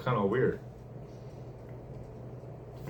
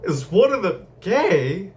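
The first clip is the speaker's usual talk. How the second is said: slow, high, drawn-out mocking repeat; mock echo; 9 semitones higher, 5 dB louder, slower than usual. Teen mocks classmates' question about the gangster kids. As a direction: parrot the question back in a high, silly voice. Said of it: "I was like".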